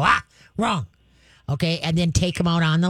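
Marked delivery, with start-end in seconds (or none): 0.0-0.9 a quick, firm "ah" to stop the puppy; sharp correction. Shown as a demonstration. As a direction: startle the dog with a sharp correction. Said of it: "clap your hands or stomp your feet and go".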